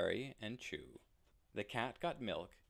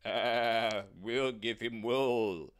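slow, louder sheep voice; drawn-out woolly bleat; 8 dB louder, much slower than usual. Acted. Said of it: "said the sheep".

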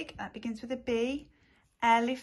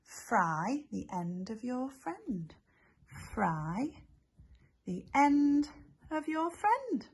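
slow recital; 2 semitones higher, slower than usual. Shown as a demonstration. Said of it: "What was the saying?".

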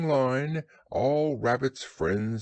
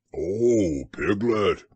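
louder, lightly excited bear; excited Pooh; 4 semitones lower, 5 dB louder, much slower than usual. A narrator's reading. Pooh comes upon Piglet, excitedly calls out. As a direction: hail a friend eagerly in a bear's voice. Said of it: "said Pooh excitedly".

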